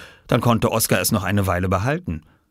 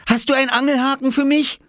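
put-on higher, breathless child asking; a small boy begging excitedly; eager little boy; 4 dB louder than usual. Oskar asking the farmer for a fish hook, eager.

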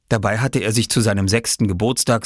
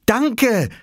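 higher, bright called thanks; boy calling thanks; 4 dB louder than usual. Acted. Oskar calling thanks to the farmer as he runs. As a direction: shout thanks while running off. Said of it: "rief Oskar".

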